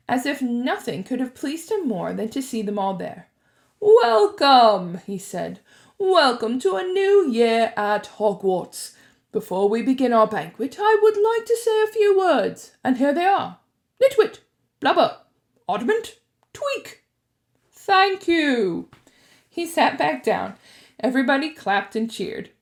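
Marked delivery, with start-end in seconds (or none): 3.8-5.0 loud, falling greeting to everyone; booming welcome. A narrator's reading. Headmaster welcoming the students, booming voice. 6.0-18.9 put-on loud, higher, whimsical old wizard; Dumbledore. A narrator's reading. Headmaster addressing the students before the feast, loud whimsy.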